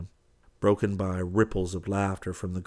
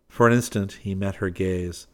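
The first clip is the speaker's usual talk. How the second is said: plain reading voice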